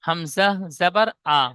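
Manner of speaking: hamza zabar a, each sound spelled out slowly; fatha recitation drill. Shown as a demonstration. Teacher slowly models হামজা জাবার আ for students. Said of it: "ঠিক এমন জাবার লাগিয়ে প্রত্যেকটা পড়া পড়ব".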